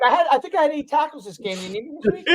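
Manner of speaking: high, laughing mock plea; mock pleading. Player asking Kyle to be interviewed, high laughing mock plea. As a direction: beg to be picked, half laughing.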